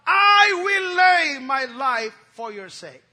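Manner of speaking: slow, loud, shouted vow; Peter's vow. Acted. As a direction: roar it out as a bold promise. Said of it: "Peter said unto him".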